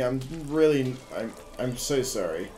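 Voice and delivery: ordinary talk, nothing marked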